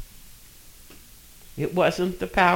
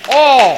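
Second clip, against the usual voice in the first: all punched out loud and high on its own; stress on all; 4 semitones higher, 10 dB louder than usual. Shown as a demonstration. Preacher stressing all to his congregation, loud punched word. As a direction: hammer the single word all. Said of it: "And I emphasize that word".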